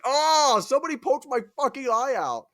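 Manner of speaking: high, loud cry of pain; mock agonised wail. Cyclops telling his brothers of injury, mock agony. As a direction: wail the complaint in hammed-up agony.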